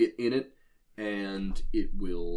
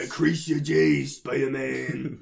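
mock meme hype; 5 semitones higher, 6 dB louder, slower than usual. Meme quote shouted.

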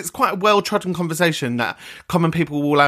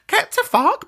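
high-pitched, exasperated outburst; mock outraged dismissal. Scoffing at pressure to buy presents, mock outrage. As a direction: pitch it way up, indignant.

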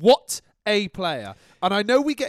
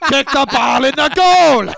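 mock touchline yell; 8 semitones higher, 14 dB louder, slower than usual. Mock fan yelling at Ferguson from the stands, full loud shout. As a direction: bellow it like a frantic fan, much louder and higher.

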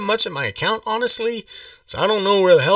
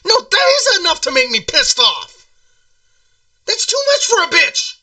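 much higher, strained outrage; outraged; 8 semitones higher than usual. Acted. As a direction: squeal your outrage at the price. Caller outraged at seven hundred.

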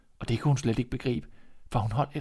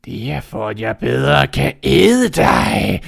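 slow, much louder roaring threat; menacing wolf; 11 dB louder, much slower than usual. Acted. Wolf to Red before eating her, slow and roaring. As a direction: build to the pounce.

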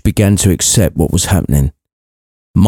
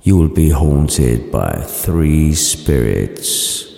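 low, slow spectral boom, sinking deeper at the end; ghostly voice; 5 semitones lower, 3 dB quieter, much slower than usual. Acted. Marley's ghost telling Scrooge of spirits, deep slow voice.

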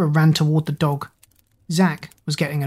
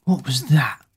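plain reading voice

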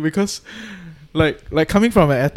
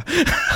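burst of laughter. Real, not acted. Teasing sports science students, cracking up laughing.

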